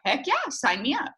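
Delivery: high-pitched enthusiastic agreement; eager yes. Acted. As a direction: jump at the offer. Acting the won-over friend's reply.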